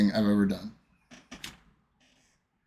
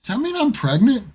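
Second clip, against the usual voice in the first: much higher, louder naive asking; dopey high question; 14 semitones higher, 5 dB louder than usual. Retold or imitated. Doped patient asking ultrasound tech, high and loud. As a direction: ask it like a drugged innocent.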